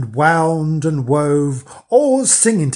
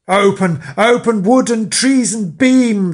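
loud, high raging cry; high, loud witch's cry; witches crying out; 7 semitones higher, 6 dB louder than usual. A narrator's reading. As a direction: hurl it at the door in fury. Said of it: "they cried to the door".

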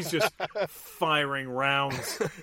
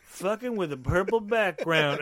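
mock drunken cursing, pitched up; 5 semitones higher than usual. Drunk man curses from the top of the stairs, slurry. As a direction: stretch the curse out like a lightly played drunk. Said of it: "he's drunk as fuck".

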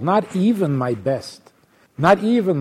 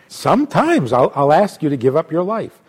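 ordinary talk

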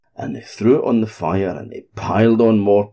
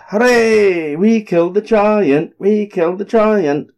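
high, loud cheer of triumph; 12 semitones higher, 4 dB louder than usual. A narrator's reading. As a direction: shout it out in victory. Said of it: "they went".

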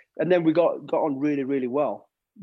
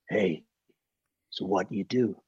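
Clint Eastwood voice; 6 semitones lower than usual. Retold or imitated. Clint Eastwood sizing up the bodyguard on set, low American accent. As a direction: lean down and speak low like Clint. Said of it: "in that accent".

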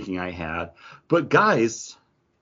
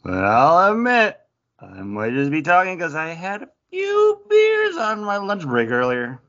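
hammed-up drunk; 7 semitones higher, 3 dB louder than usual. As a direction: play a coroner who had a few beers. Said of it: "I just wanted to play it up like he is drunk".

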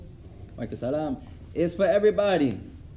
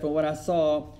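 calm sheikh; 5 semitones lower, 4 dB quieter than usual. Retold or imitated. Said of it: "So the Shaykh said".

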